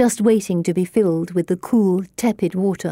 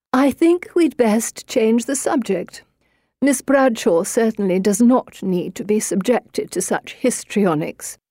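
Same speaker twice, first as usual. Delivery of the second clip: dismissive grandmother; 4 semitones higher than usual. Acted. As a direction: dismiss her coldly. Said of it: "Grandmama dismisses me".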